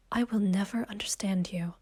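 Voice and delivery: hushed, breathless whisper; a wounded whisper as he leaves. Acted. Isabella's hurt reply at parting.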